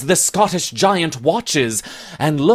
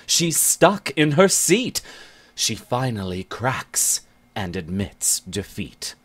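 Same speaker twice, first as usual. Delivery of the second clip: usual speaking voice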